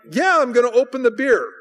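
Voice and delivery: high-pitched mock exasperation; exasperated retort. Guest answering pushy brothers, exasperated. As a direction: act out losing patience with nagging. Snaps back at the badgering.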